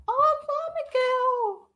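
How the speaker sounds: high, slow, sing-song hello; light up and greet a friend; delighted greeting. Real, not acted. Greeting viewer in chat.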